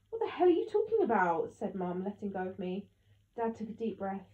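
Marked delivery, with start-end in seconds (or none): 0.1-1.5 high, loud outburst; angry disbelief. A narrator's reading. Mum confronting Dad about his confession, angry disbelief. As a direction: demand an answer, loud and high.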